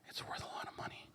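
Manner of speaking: very soft, quick imitated whisper; barely voiced, a furtive confidence; secretive whisper. Dad confiding the bracelet's value to son, secretive whisper.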